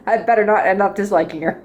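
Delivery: ordinary delivery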